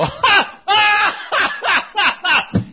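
loud, high "ha" bursts; imitated happy laugh. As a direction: holler out a string of big mock laughs. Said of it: "just like that".

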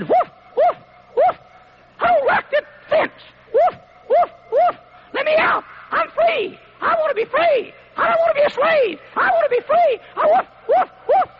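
very high, squeaky little dog barking its words; yapping Chihuahua voice. Retold or imitated. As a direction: yap it out like a tiny dog begging to get out. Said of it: "heard what he was saying while he was barking".

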